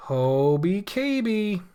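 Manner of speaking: ordinary talk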